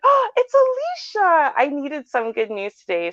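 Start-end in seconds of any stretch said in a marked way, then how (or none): 0.0-1.5 high, slow, delighted gasp of recognition; delighted surprise. Retold or imitated. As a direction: sing out her name in happy surprise. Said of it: "I'm like".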